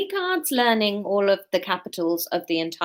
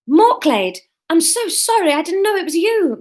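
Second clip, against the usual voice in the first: high, loud, rushing-out apology; flustered apology; 9 semitones higher, 7 dB louder than usual. A narrator's reading. Marine to the friend she tackled, flustered apology. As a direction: apologise in startled embarrassment, pitch jumping up.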